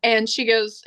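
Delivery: ordinary delivery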